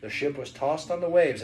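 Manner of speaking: ordinary delivery, nothing marked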